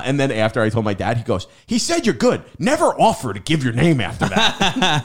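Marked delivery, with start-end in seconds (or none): 1.7-5.0 high, loud dad telling-off; imitated scolding dad. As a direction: lecture like an exasperated dad. Retold dad scolding his child.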